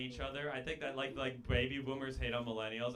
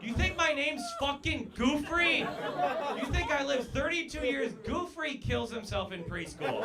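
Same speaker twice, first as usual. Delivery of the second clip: indignant rant; 11 semitones higher, 5 dB louder than usual. Acted. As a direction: rage over the name, stretching each word.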